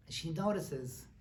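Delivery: usual speaking voice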